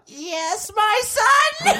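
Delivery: high God voice. Acted. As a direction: squeak it out as God.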